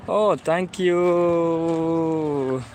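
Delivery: ordinary talk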